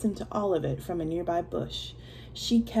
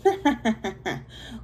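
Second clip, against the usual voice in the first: king laughing; 3 semitones higher than usual. Acted. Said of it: "laughed the king".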